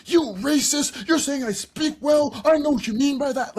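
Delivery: hammed-up furious outburst at her; mock scream. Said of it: "If I were to scream at her".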